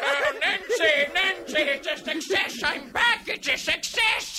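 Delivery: put-on high, elated old man voice; excited old man. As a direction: crow with old-man triumph. Old man returns from time travel.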